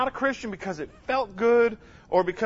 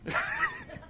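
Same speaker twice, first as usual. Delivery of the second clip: high burst of laughter; 8 dB quieter than usual. Real, not acted. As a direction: let a high laugh break out. Laughs at audience.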